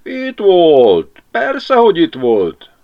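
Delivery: Jóska lying to Mari, sleepy and yawning. Acted. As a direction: drawl it through a yawn. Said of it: "ásított Jóska".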